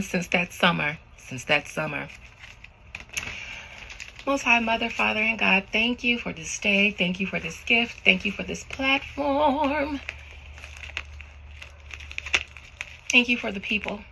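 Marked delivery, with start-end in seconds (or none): 4.3-14.0 soft, measured invocation; prayer. Real, not acted. Reader asking God to conjure a spirit, soft prayer. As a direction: pray softly, drawing out key words.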